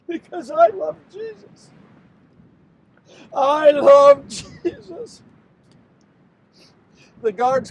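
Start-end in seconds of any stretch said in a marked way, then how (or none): 0.1-1.7 high, fragile avowal of love; anguished confession. Acted. Judas breaking down after the kiss. 3.3-5.2 loud, drawn-out declaration of love; anguished avowal. Acted. Repeats love, louder and slower.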